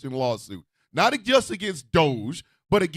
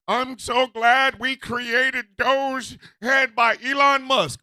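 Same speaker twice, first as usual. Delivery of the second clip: mocking Trump quote; 4 semitones higher, 4 dB louder, much slower than usual. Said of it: "and he says".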